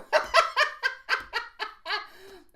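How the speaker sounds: loud high burst of laughter; real delighted laugh; big laugh. Real, not acted. Reader laughing at a viewer's comment.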